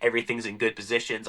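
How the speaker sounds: usual speaking voice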